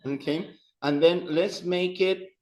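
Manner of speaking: usual speaking voice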